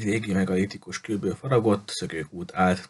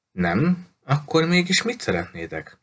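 taken aback; 6 semitones higher than usual. A narrator's reading. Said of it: "megrökönyödve, és hangja élessé vált".

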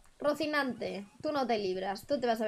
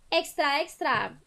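newsboy shout; 4 semitones higher, 3 dB louder, slower than usual. Retold or imitated. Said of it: "el niño gritaba".

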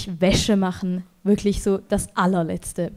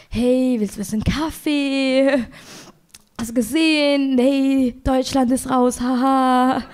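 awkward small talk; slower than usual. Retold or imitated. Nervous small talk with electrician.

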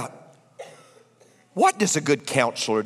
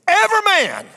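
high, loud pulpit cry; preaching shout; 6 dB louder than usual. Real, not acted. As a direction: lift it like a preacher. Preacher building to climax.

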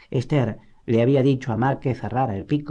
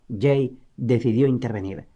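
ordinary delivery, nothing marked

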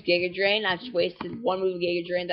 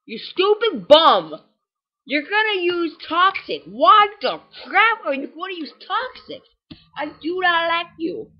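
angry yelling; 9 semitones higher, 5 dB louder, slower than usual. Real, not acted. Yelling at game opponent.

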